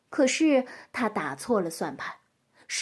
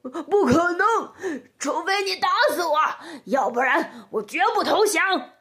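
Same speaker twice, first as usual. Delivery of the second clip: high, forceful character voice of a beaten wolf; defiant wolf; 6 semitones higher, 3 dB louder than usual. A narrator's reading. Beaten wolf refusing to admit defeat, defiant high voice. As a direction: refuse loudly, straining against defeat.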